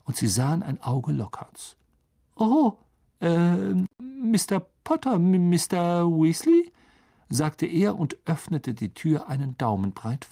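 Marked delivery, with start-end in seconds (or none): 2.4-6.7 high, halting voice, louder; awkward, hesitant greeting pitched high; flustered Lockhart. A narrator's reading. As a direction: caught off guard, stumbling over the names. Lockhart speaks through door slit.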